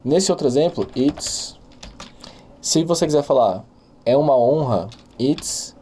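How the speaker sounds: ordinary talk, nothing marked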